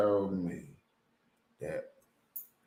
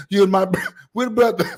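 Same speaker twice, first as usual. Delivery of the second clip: laughing mock greeting; 9 semitones higher, 7 dB louder, faster than usual. Imagined Jamaicans greeting them.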